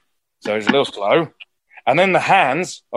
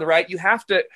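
ordinary talk, nothing marked

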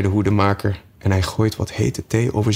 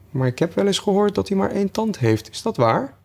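put-on much higher, careful girl voice; cautious Alice; 11 semitones higher than usual. A narrator's reading. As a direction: ask carefully, a bit unsure. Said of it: "gaf Alice voorzichtig ten antwoord". (voorzichtig, hoog meisjesstemmetje)

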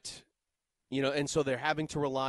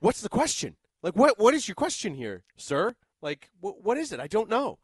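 louder, higher mock bafflement; exasperated; 4 semitones higher, 6 dB louder than usual. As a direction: cut in, raised and impatient. Acting out baffled response to rambler.

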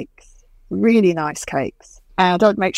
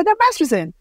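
higher lightly voiced colleague; 9 semitones higher than usual. Colleague explaining the cake, lightly voiced and higher. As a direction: toss it off like a coworker.

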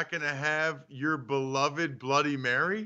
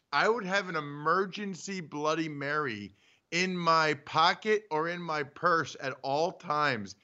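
usual speaking voice

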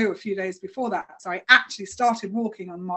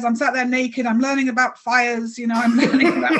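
ordinary talk, nothing marked

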